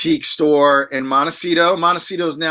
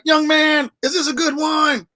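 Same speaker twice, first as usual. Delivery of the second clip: a Texas drawl, pitched well higher; a movie-star customer, Texas accent, much higher; 12 semitones higher than usual. Retold or imitated. Texas accent imitated for the listener. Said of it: "his Texas accent".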